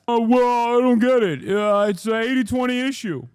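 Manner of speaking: high, loud whiny voice; mock pundit. Mocking Democratic strategists in a high, loud whiny voice. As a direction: whine it like a clueless pundit.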